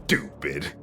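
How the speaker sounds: ordinary delivery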